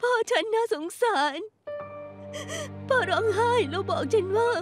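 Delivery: distraught, catching breath between phrases; weeping, broken into short gasped phrases; tearful recounting. Acted. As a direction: plead through tears after a nightmare. Tearfully recounting nightmare of dead father.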